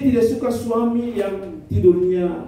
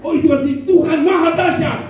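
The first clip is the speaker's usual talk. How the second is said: high mock praise; 10 semitones higher, 4 dB louder than usual. Wife praising over snoring.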